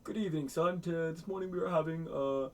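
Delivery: imitated stately dad; mock formal father. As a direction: play the grand father announcing breakfast, drawing out the end. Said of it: "And his father goes".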